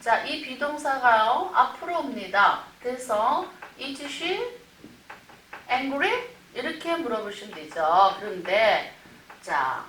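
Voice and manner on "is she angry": angry rises at the end; rising question tune. Shown as a demonstration. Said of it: "인토네이션이 중요하죠".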